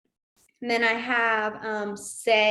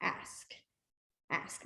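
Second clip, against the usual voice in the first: soft whispered ask while signing; whispered demo; 3 semitones lower, 12 dB quieter, much slower than usual. Shown as a demonstration. Sign teacher demonstrating the sign, barely voiced. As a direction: breathe the word ask almost silently. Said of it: "the big proper one is ask".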